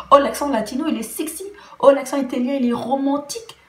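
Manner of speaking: high-pitched mock gushing; mock swooning. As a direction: imitate someone swooning over an accent, loud "oh" openings. Mimics people swooning over accents.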